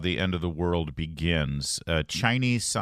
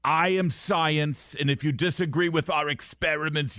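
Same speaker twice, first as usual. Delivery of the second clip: pitched-up mock Fauci, very slow on the first words; Fauci impression; 8 semitones higher than usual. Host playing the scientist to his co-host, as a hammy Fauci. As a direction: lord it over them as a hammy Fauci. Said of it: "that's my Fauci".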